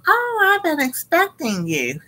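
high, knowing sing-song greeting; eerie hostess. Retold or imitated. The cooking lady greets the kids she expected, high and eerie. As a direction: play the creepy lady who already knew.

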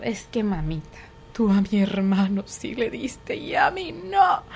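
a hint of a whining child, slow; whiny child. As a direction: mimic a child guilting mom. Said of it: "niño chantajista imitado". (imitación leve de niño quejumbroso)

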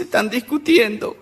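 niña llorosa, imitada. Acted. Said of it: "Estaba una chiquita llorando".

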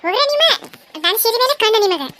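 high, loud mock protest; mock indignant. Woman playfully scolding sisters eyeing her saree, high and loud. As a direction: playfully shoo them off.

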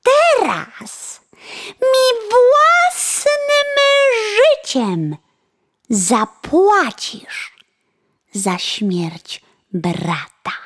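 high, loud, slow menace fading at the end; vengeful threat. Acted. Sparrow threatening the coachman's life, high then fading. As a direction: promise his death, drawn out.

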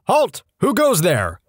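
high "Halt!", then question dropping; guard's challenge. Acted. Soldier guarding turd all night, sentry challenge.

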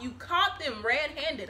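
ordinary talk, nothing marked

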